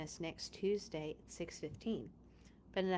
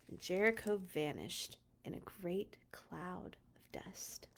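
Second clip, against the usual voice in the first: fading whisper; 3 semitones lower, 8 dB quieter than usual. Acted. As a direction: whisper it away into nothing. Dramatic ending of the collapse.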